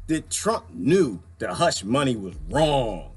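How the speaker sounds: mock Cohen testimony. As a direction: drag out the outrage in mock drama. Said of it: "So Cohen said".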